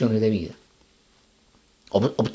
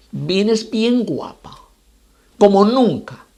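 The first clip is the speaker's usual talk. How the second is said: "bien guapa" very slow and higher; emphatic instruction; 5 semitones higher, much slower than usual. Acted. Rabbi instructs the woman on her appearance, emphatic. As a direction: linger on "bien guapa", then punch "como nunca".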